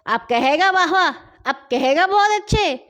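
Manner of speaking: angry men beating Hasan; angry threat. Acted. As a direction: bark it while hitting him. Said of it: "مارتے جاتے اور کہتے جاتے".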